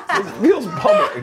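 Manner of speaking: slow, high mock complaint; high-pitched protest. Joking about the bucket on a plane, laughing high protest. Punchline of own retold complaint.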